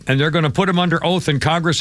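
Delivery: normal talking voice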